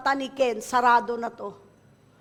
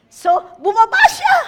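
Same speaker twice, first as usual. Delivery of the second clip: preaching cry; 9 semitones higher, 12 dB louder than usual. Real, not acted. Preaching testimony climax.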